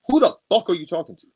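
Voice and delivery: high, loud and fast furious yell; angry shout. Retold or imitated. Retelling snapping at the ex at the party, shouting. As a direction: snap and let her have it. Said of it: "I snapped".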